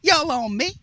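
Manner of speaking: high, slow twangy imitation of Ricky; Harrisburg twang. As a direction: drawl it out like a Harrisburg running back. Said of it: "'Cause that's how he talked".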